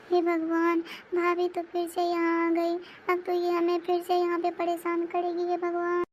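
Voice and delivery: high-pitched, anxious lament to oneself; fearful fretting. Acted. Bride dreading her sister-in-law's return, high anxious fretting. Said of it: "क्यों बड़बड़ा रही हो".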